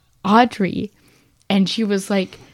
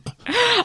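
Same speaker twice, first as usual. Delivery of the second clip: sharp gasp. Real, not acted. Sudden realization mid-conversation.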